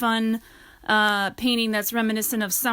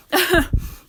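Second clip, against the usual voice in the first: light laugh; 8 semitones higher, 5 dB louder than usual. Real, not acted. Laughs at herself.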